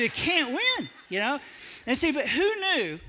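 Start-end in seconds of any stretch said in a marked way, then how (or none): 0.0-0.9 exasperated outburst. Retold or imitated. Punchline about the argument with his wife.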